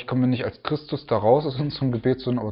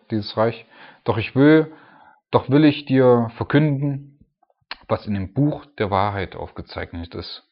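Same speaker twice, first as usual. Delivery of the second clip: scripture reading; 4 dB louder than usual. Acted. Preacher reading the angel's message about Persia aloud. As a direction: proclaim the angel's speech.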